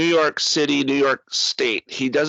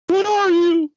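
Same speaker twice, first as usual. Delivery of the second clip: much higher, frightened movie thug squeak; scared thug; 4 dB louder, slower than usual. Retold or imitated. Podcaster quoting the frightened thug from the movie, high scared squeak. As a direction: play the terrified first guy facing Batman.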